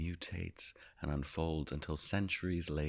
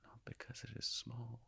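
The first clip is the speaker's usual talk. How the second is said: soft, raised pitch, spaced-out phrases; hushed storytelling; 5 semitones higher, 7 dB quieter than usual. Acted. Revealing to listeners the tiny planet in front of them, hushed. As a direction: hush it in wonder, pausing long between images.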